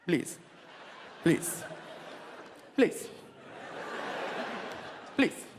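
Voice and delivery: put-on curt, accented "please" with long gaps; man of one word. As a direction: imitate a driver's terse, repeated request. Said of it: "his favorite word was".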